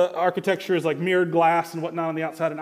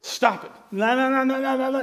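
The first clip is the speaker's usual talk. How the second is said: mock stubborn refusal; 5 semitones higher, 3 dB louder than usual. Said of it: "we do one of those to Satan".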